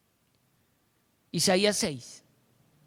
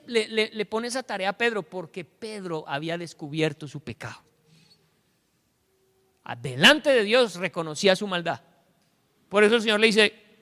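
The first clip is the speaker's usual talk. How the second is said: usual speaking voice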